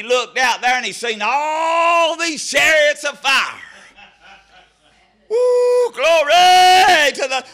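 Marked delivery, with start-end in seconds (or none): 5.3-7.4 high whoop then a loud slow glory; excited whoop. Real, not acted. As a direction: cry out as the spirit hits. Preacher whoops mid-sermon.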